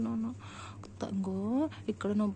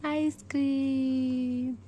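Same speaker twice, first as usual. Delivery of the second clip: drawn-out coaxing; 4 semitones higher, 4 dB louder, much slower than usual. Real, not acted. Coaxing crying child with treat.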